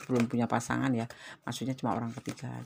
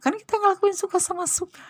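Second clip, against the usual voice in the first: much higher and louder mock quote; mocking the man's words; 7 dB louder than usual. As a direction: squeal it back like a careless guy's excuse. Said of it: "Seenak jidatnya aja ngomong". (meniru ucapan pria dengan suara jauh lebih tinggi dan keras)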